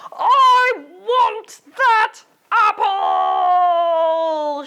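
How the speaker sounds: slow, high, loud furious shout; angry shout. Acted. Marvin yells at Molly over the eaten apple, furious slow shout. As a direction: drag out the yell like a tantrum. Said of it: "shouted Marvin".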